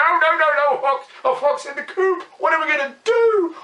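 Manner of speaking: high, loud squawking panic; panicked chickens. Acted. Chickens in the coop, panicking at the fox in a high loud voice. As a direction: flap and shriek in fright.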